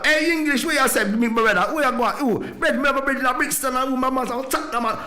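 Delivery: hammed-up street vendor voice, calling out to him; girl hustler calling out. Girl at the airport hails the English visitor, in a high mock Jamaican call. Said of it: "I hear some girl".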